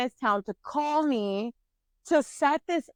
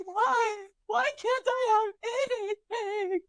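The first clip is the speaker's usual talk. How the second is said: high mock-sobbing wail; imitated crying; 9 semitones higher, much slower than usual. Woman replaying her bathroom breakdown, high tearful mock wail. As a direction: wail it high and drawn out, hammed-up. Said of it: "crying. Like".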